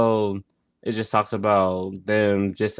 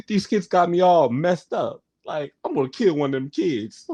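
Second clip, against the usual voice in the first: Bernie talking to America; 6 semitones higher than usual. Retold or imitated. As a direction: vent to the audience like fed-up Bernie. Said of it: "telling America how".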